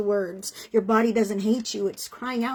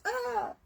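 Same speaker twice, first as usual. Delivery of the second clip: high, soft wounded little gasp; a hurt child's tiny cry; small hurt gasp; 13 semitones higher, 7 dB quieter than usual. Retold or imitated. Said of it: "inner child inside that goes".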